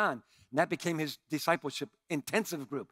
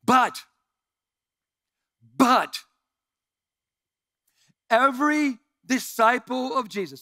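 loud, higher "but", long pauses; emphatic buildup; 7 semitones higher, 9 dB louder, slower than usual. Real, not acted. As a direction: hold them with loud pauses. Pastor pausing for emphasis.

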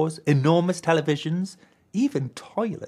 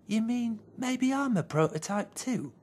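soft, higher small-bear voice; a puzzled little bear; a shy little bear, puzzled by the hammer; little teddy bear; 6 semitones higher, 4 dB quieter than usual. A narrator's reading. Green asks.

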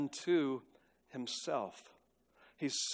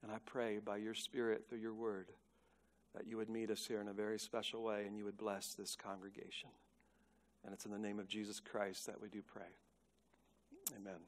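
low, very soft praying voice; prayer; 5 semitones lower, 12 dB quieter than usual. Real, not acted. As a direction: pray softly and reverently before the congregation. Closes an opening prayer.

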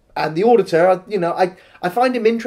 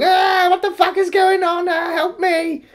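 much higher panicked yelping in a strange voice; panicking man; 13 semitones higher than usual. Retold or imitated. Mimicking the panicking man at the start of the film, high and frantic. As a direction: play the slobbering man losing it in terror. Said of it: "this slobberly man panicking".

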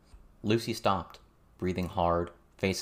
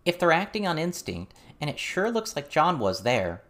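high, heated raised voice; pitched up, pressing the point; 9 semitones higher than usual. A narrator's reading. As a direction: raise your voice in argument. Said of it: "louder, raising her voice more".

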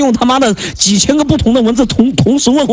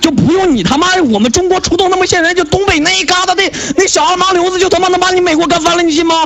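high, fast furious tirade; taunting angry outburst at the stranger; heated shouting rant; 9 semitones higher than usual. Real, not acted. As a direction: rant at him, pitch climbing higher. Escalating rant, taunting partner.

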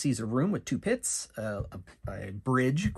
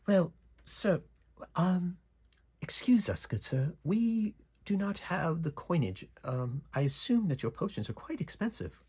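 higher, formal adventurer voice; polite adventurer; 7 semitones higher than usual. Acted. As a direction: play the courteous party member, pitched up. Adventurer politely declines potions.

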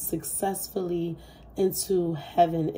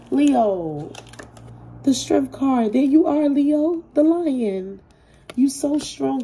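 excited exclaiming; 6 semitones higher, 6 dB louder than usual. Real, not acted. Reveals the strength card, exclaiming.

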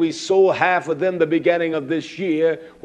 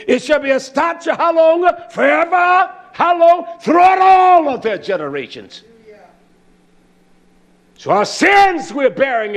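much higher and louder, slow, shouted; preaching at full force; 10 semitones higher, 7 dB louder than usual. Acted. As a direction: rouse the congregation to battle.

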